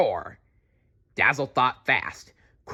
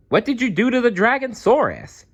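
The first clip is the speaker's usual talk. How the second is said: very high, amazed question; 4 dB louder, faster than usual. Acted. Quetzalcoatlus asks Maya in amazement, very high. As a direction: gasp it out in wonder. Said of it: "he asked in amazement".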